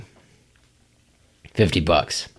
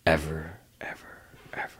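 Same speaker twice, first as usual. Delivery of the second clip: fading repeated echo, each softer; digital echo; 6 semitones lower, 13 dB quieter, much faster than usual. Acted. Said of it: "that epic echo effect Charlie".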